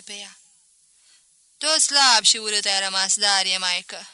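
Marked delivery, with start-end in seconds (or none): 1.6-4.1 loud, familiar teasing; teasing mother-in-law. Acted. Mother-in-law greets visitor Darie, loud teasing. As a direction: size him up out loud.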